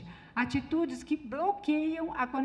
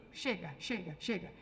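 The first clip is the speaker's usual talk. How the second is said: low, softer curt cut-off; weary, lowered brush-off; dismissive husband; 6 semitones lower, 8 dB quieter, slower than usual. Retold or imitated. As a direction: imitate a man closing the subject. Man shutting down the conversation.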